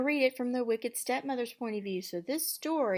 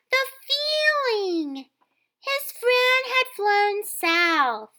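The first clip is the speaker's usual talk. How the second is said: put-on much higher, excited young girl; chirpy girl voice; 13 dB louder, slower than usual. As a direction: squeak it like a dreamy girl. Cindy tells a story.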